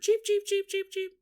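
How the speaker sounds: high mock bird cheeps; bird chirping. Sleepy daytime bird chirping in the trees, high mock cheeps.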